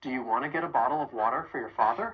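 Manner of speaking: rapid and run together; quick American reductions; fast connected speech. Shown as a demonstration. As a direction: rattle it off smoothly. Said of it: "One more time".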